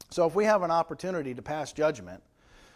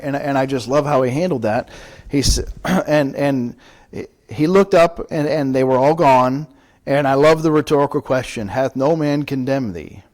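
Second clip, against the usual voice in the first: much louder, driving sermon delivery; full-force preaching; 12 dB louder than usual. Real, not acted. Pastor preaching loudly to his congregation about Jesus.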